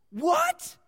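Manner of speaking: loud, high-pitched incredulous "what"; stunned outburst. Acted. Onlooker watching the madman kneel, stunned. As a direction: burst out in disbelief, high and loud.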